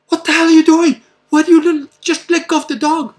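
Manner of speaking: high, loud exasperated yell; father yelling at his son after catching him; angry scolding. Retold or imitated.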